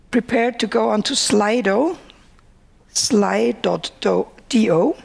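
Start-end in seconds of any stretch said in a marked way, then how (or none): none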